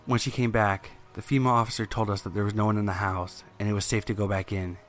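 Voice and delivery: usual speaking voice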